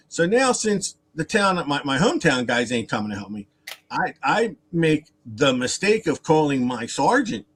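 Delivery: normal talking voice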